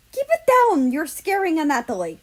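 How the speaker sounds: high, loud shout that tapers off; scolding shout. Acted. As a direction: yell at them to hush for the cat. Russia scolds over frightened cat.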